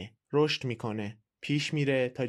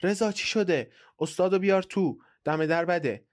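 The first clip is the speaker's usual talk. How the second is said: higher, louder wife calling out; raised voice; 5 semitones higher, 4 dB louder than usual. A narrator's reading. Wife calls Reza from the window, raised voice. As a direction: call out to your husband, voice pitched up. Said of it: "صدایش را بلند کرد".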